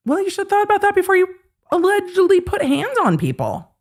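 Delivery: very high, fast sarcastic retort; mock retort. Mocking the judge answering Ron, high and fast. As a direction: snap back in a squeaky mock voice.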